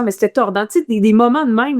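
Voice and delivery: normal talking voice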